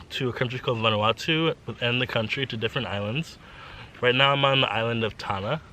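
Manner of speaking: normal talking voice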